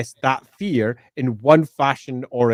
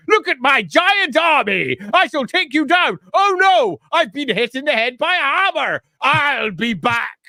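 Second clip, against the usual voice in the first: mustache twirling villain; 12 semitones higher than usual. Retold or imitated. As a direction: ham up a cartoon villain. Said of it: "They're almost all written the same".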